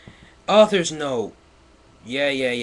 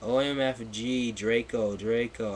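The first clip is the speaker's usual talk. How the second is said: ordinary talk, nothing marked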